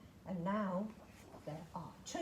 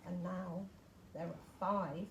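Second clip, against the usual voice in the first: ordinary delivery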